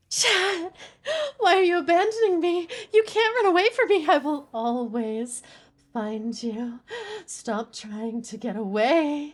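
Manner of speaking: possessive yandere voice, slowing to a creepy promise; yandere voice line. Pretend. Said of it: "Thank you for the voice line".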